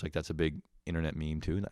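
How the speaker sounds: ordinary talk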